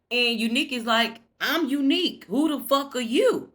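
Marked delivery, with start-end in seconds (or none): 1.4-3.5 mock defiant retort. Unique snaps back at kidnapper.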